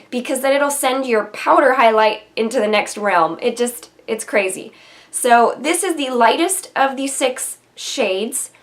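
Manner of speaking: ordinary talk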